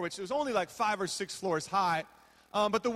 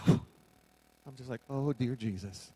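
desperate sigh; 10 semitones lower, slower than usual. Retold or imitated. Panicking, praying under breath.

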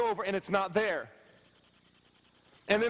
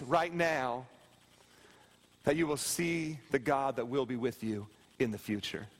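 hushed preaching; 7 semitones lower than usual. Acted. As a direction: lean in and murmur it to each soul. Softens back to hushed preaching.

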